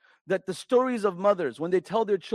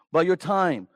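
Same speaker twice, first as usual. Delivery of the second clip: ordinary delivery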